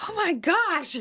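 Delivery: high, astonished exclamation; shocked realization; amazed. Retold or imitated. Both realize the coincidence.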